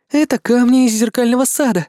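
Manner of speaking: demoness voice. Acted. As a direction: voice the demoness, light and high. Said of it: "ответила демоница".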